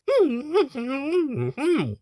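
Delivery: squeaky high-pitched giggle. Acted. Gagged doctor giggling at her own joke, high giggle.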